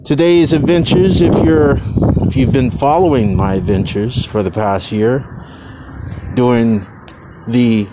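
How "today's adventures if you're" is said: usual speaking voice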